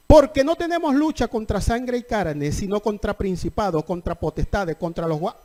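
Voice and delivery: predicación a plena voz, algo más fuerte. Acted. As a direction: proclama el pasaje a la iglesia. (predicación fuerte y proclamada)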